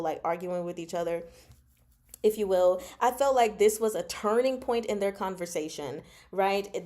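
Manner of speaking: usual speaking voice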